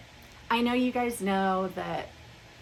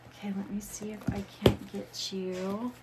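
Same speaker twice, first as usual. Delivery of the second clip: whisper. Real, not acted. Adjusting camera.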